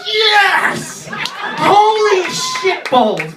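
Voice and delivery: ecstatic shout. Real, not acted. Comic retelling his crush's invite, he shouts with joy. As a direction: scream it like you just won.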